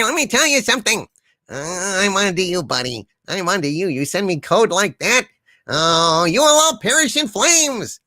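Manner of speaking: a lightly voiced blustering villain, mostly defiant; Harvey character voice. Harvey, stuck behind a force field, refuses to enter the chat, lightly voiced blustering villain. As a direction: play the boastful villain trapped in his field, refusing the chat.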